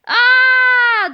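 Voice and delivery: high, loud scream of fright; terrified shriek. Acted. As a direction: shriek in pure panic. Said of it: "the frightened principal shrieked".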